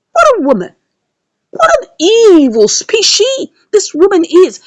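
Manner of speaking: ordinary talk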